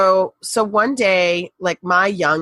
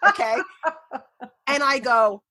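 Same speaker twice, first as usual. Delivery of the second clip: ordinary talk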